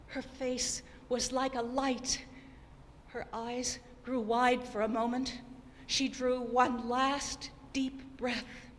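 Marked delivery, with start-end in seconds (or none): none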